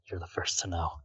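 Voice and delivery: soft quick whisper. Acted. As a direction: whisper it to the little brother. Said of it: "I'm just gonna quickly whisper".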